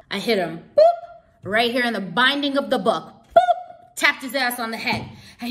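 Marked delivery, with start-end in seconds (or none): 0.8-1.4 very high, loud "boop"; cartoonish high bonk; imitated bonk. Imitates book hitting his head. 3.1-4.0 very high-pitched "boop"; tiny cartoon hit sound; imitated bonk. Imitates book hitting his head.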